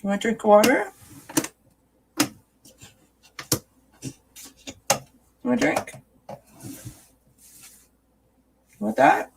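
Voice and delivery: high, coaxing pet voice. Real, not acted. Mommy offering Charlotte water, high coaxing baby talk. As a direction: lure her in sweetly, voice up high.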